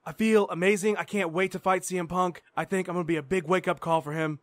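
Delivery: normal talking voice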